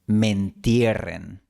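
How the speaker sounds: mentierren, slow, me joined to entierren; fused mentierren. Shown as a demonstration. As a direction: say mentierren as one word, slowly. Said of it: "se pronuncia".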